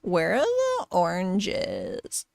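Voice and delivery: low, very drawn-out puppet voice; slow talking-orange character; demonstrated orange puppet voice. Shown as a demonstration. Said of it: "gotta sound like this".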